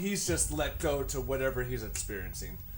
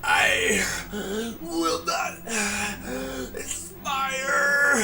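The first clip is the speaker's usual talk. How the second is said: wounded villain; 6 semitones higher, 5 dB louder, much slower than usual. Acted. Wounded enemy gasps defiance.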